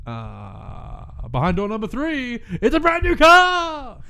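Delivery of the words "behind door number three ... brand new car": loud, high game show host; booming prize announcer. Pretend.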